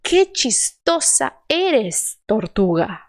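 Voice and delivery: slow, clear Spanish with a closed "Que"; slow model sentence. Shown as a demonstration. Teacher models the sentence again. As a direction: read it slowly, e kept closed. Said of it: "Você vai manter fechado".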